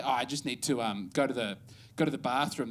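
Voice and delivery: low, soft mock Aussie accent; Australian impression. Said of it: "they do an impression of".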